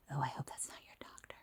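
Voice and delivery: hushed, confidential whisper. Retold or imitated. Mom to her child about the passing old man, whispered. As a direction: whisper it quickly under your breath. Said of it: "sorry, I whispered that".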